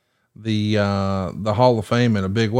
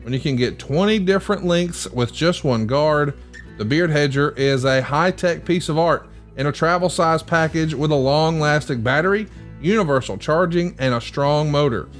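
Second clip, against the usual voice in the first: upbeat sponsor read, a little higher; 5 semitones higher than usual. Acted. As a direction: pitch the product like a host ad. Host reads sponsor ad.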